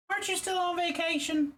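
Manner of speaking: high squeak stressing Aren't; high silly voice. Shown as a demonstration. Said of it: "I don't know why I'm saying it like that".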